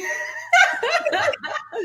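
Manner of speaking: a high breathy giggle that trails up. Real, not acted. Host still giggling over the grumpy friend, high giggle. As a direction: keep giggling, pitched high.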